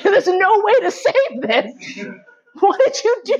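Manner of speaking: high, incredulous scolding; mock disbelief. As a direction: act appalled at the ruined bag. Acting out disbelief at boyfriend.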